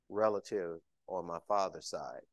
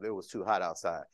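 ordinary talk

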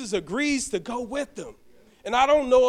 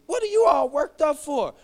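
elder's rebuke; 6 semitones higher than usual. Retold or imitated. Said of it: "Pare said".

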